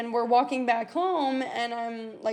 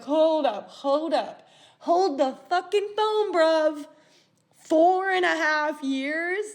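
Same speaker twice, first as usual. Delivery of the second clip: higher-pitched, emphatic halting disbelief; incredulous; 5 semitones higher, 4 dB louder, slower than usual. Retold or imitated. Learning they were together for years, incredulous. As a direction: stop him cold, stretching out the shock. Stunned by relationship's length.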